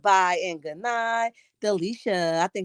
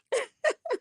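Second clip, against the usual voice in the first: high squeal of laughter; 6 dB quieter than usual. Real, not acted. Laughing at viewer comment.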